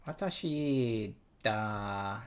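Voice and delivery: very slow, drawn-out "Watashi da"; careful slow reading; slow practice. Shown as a demonstration. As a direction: stretch "Watashi da" syllable by syllable. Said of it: "drawn out practice".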